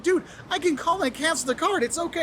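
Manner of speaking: hammed-up protest that it's no big deal; mock surprised protest. Telling locals prying up the floor to stop, flustered protest. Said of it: "I'm like".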